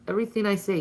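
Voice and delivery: ordinary talk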